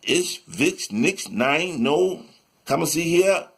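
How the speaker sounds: put-on German accent. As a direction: imitate a German speaker, word by word. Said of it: "talk with a German accent".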